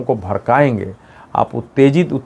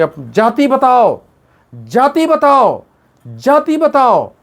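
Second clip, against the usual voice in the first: high, slow, shouted demand; mocking shout; 10 semitones higher, slower than usual. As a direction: mimic a leader yelling at a reporter. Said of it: "उससे चिल्ला के पूछेंगे". (ऊँची आवाज़ में चिल्लाकर नकल)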